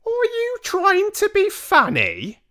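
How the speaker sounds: a prim lady looking down her nose. Acted. Mother coldly rebuking the grandmother, prim and prissy. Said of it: "Missus Jenkins said, very prim".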